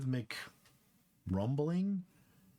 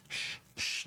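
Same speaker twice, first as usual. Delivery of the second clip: shushing; 3 dB quieter than usual. Acted. Shushing the monkeys.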